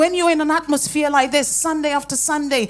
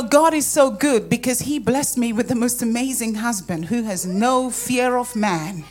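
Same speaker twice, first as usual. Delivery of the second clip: usual speaking voice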